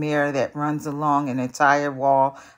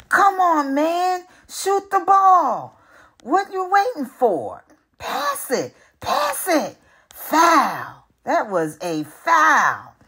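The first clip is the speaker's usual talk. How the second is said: very high, loud sports-fan yelling; 10 semitones higher, 5 dB louder, slower than usual. Acted. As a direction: scream at the game like a frustrated fan. Fan yelling at basketball game.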